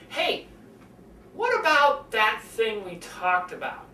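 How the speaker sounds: slow, deliberate turn to something important; measured serious question; shift to serious. Shown as a demonstration. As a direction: lean in and slow down, signalling it matters. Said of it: "we're moving from chatting to talking about something really important".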